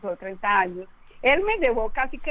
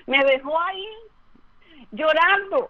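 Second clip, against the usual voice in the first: laughing retelling; 3 semitones higher, 4 dB louder than usual. Retold or imitated. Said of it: "llorando, temblando de miedo".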